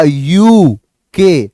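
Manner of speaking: very slow यूके, starting with a clear यू sound; slow demo. Shown as a demonstration. Teacher demonstrating यूके slowly to students. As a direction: draw out यूके slowly for the class. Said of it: "तो सीधा क्या आया".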